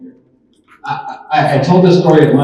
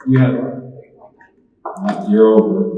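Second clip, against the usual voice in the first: normal talking voice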